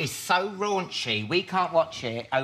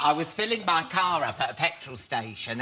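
usual speaking voice

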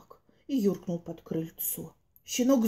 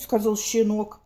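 ordinary delivery

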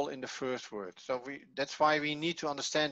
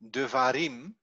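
devarim with the accent on its last syllable; final-syllable stress. Shown as a demonstration. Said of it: "the accent is on the last syllable".

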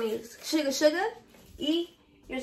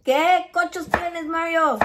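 imitating her Texas cousin, louder and higher; 6 semitones higher, 6 dB louder than usual. To Mario, mimicking her cousin from Texas: loud slangy Spanish. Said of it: "That's how my cousins talk".

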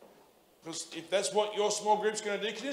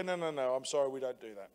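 lower, much softer polite decline; a mild, dry no-thank-you at the door; lower, quiet, deadpan polite refusal; polite mock refusal; 6 semitones lower, 6 dB quieter, faster than usual. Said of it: "Just go".

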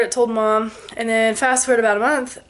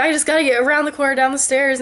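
fast, urgent inner thought; rushed thought about escaping a killer; panicked rush; 4 semitones higher than usual. Retold or imitated. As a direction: rattle it off in a hurry.

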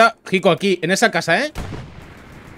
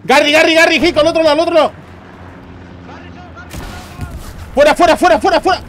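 loud urgent shouting, higher pitch; shouting callouts; 10 semitones higher, 3 dB louder than usual. Real, not acted. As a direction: yell the enemy positions to the squad. Urgent enemy callouts in firefight.

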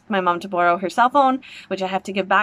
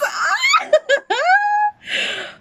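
much higher squealing laugh-cry. Real, not acted. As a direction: laugh and squeal with giddy joy. Reacting live to trailer.

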